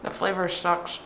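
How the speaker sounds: talking through toothpaste foam; while brushing teeth. Real, not acted. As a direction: speak with a mouth full of toothpaste. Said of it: "now that you got toothpaste in your mouth".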